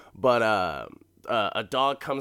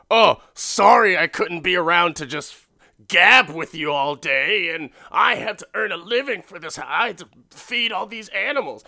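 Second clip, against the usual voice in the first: higher, louder indignant rant; 7 semitones higher, 7 dB louder than usual. Retold or imitated. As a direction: vent like the breadwinner nobody thanks. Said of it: "going off on a rant".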